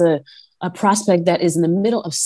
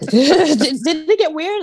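slow, laughing through the question; 12 semitones higher than usual. Real, not acted. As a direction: giggle it out, drawn out.

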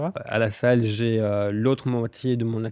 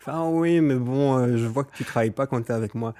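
lightly voiced client, raised and loud; quoted client voice; 2 semitones higher, 4 dB louder than usual. Quoting a client's reply.

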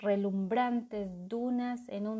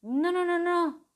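high, loud frantic denial; panicked denial; 9 semitones higher, 7 dB louder than usual. A narrator's reading. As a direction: rush to deny. Lila denies.

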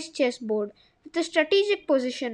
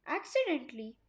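plain reading voice

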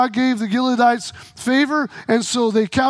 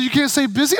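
shocked outcry; 3 semitones higher, 4 dB louder than usual. Retold or imitated. Shocked retelling of forbidden word.

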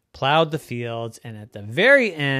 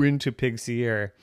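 plain reading voice

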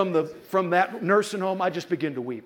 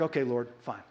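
resigned giving in; 3 semitones lower, 7 dB quieter than usual. Retold or imitated. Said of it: "So I'm like".

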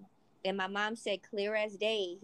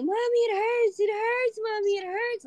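high, loud imitation of a little girl crying; mock sobbing child's plea, pitched up high; 12 semitones higher, 4 dB louder than usual. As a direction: whimper it like a hurt toddler calling for her mom. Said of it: "like, crying".

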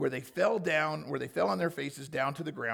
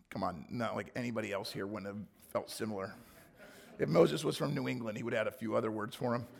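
ordinary talk

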